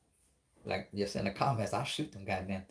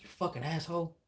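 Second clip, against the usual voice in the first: higher-pitched mock outrage; indignant retold cussing, pitched up; retold angry accusation; 8 semitones higher than usual. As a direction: accuse him, acting out the outrage. Confronts his cousin over the doll.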